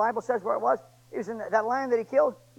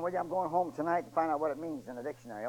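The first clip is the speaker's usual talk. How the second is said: ordinary talk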